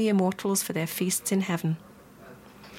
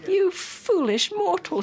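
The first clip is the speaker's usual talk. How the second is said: high, drawn-out indignant outcry; angry yell; 12 semitones higher, slower than usual. A narrator's reading. Goddess rebukes the mother who interrupted her, angry yell. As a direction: cry out in scorn at a mere mortal. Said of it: "she yelled".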